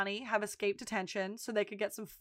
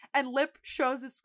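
ordinary talk

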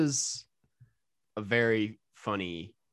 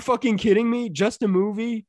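indignant disbelief; 9 semitones higher, 7 dB louder than usual. Retold or imitated. Reading outraged fan post.

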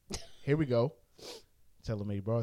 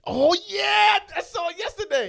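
thrilled; 10 dB louder than usual. Real, not acted. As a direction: burst out with real excitement. Excited about seeing Endgame.

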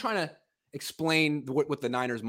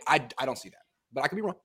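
ordinary talk

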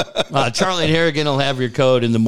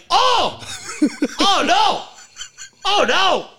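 a hammed-up sudden panic; mock panic; slower than usual. Realizing the outburst went out on air, high laughing panic. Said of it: "And I was like".

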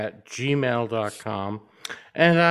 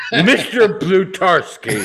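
laughing; 6 semitones higher, 7 dB louder than usual. Real, not acted. Laughing name-drop of character.

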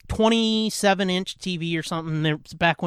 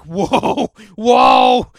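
startled whoa swelling into a much higher, much louder shout; shocked whoa, building; 5 semitones higher, 10 dB louder than usual. Retold or imitated. Walking in on his sister's sleepover, a startled shout. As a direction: recoil harder on the second whoa. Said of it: "I was like".

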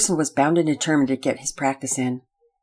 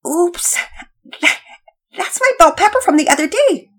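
sheepish; 12 semitones higher, 5 dB louder than usual. Acted. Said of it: "Pearson said sheepishly".

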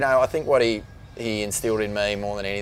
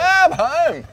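high, loud joyful yelp; overjoyed cry; 10 dB louder, slower than usual. Retold or imitated. Surfer thrilled to hear it's pumping, joyful yell. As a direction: shout it out like you can't believe it. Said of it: "And I was like".

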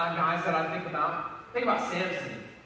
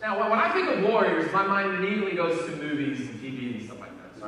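ordinary talk

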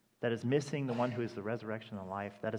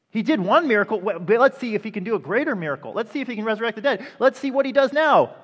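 much louder and higher, thrilled and pressing on; eager expectation; 9 semitones higher, 15 dB louder than usual. Retold or imitated. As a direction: cheer it like a crowd waiting for the big miracle. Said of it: "Or you could say".

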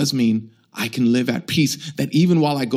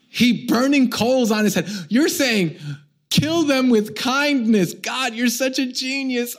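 mock gleeful delight; 9 semitones higher, slower than usual. Joking delight at revenge verse.

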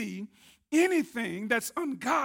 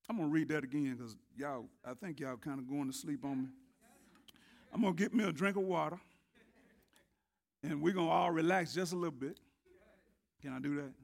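quick aside; 7 semitones lower, 7 dB quieter, much faster than usual. Real, not acted. Aside to congregation, fast.

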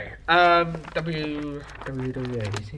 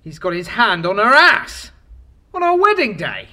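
outraged; 3 semitones higher, 8 dB louder than usual. Acted. Groom sees wife cheating.

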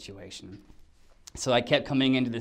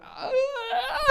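very high imitated sob; mock crying wail. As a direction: wail like an actor bursting into tears. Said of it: "I started crying".

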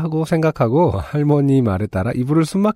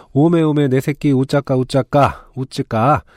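Jeolla dialect grandma; slower than usual. Retold or imitated.

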